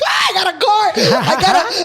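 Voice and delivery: loud, high thrilled cry; excited shout. Retold or imitated. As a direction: yell it like you just got wheels. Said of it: "I was like".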